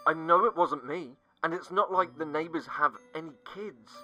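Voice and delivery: usual speaking voice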